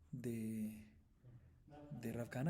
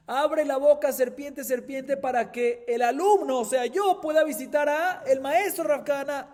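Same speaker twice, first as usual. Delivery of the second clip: high, loud imploring call to the snake; pleading with serpent; 13 semitones higher, 16 dB louder, slower than usual. Acted. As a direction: beg the serpent to open its mouth, loud and high. Third plea to serpent.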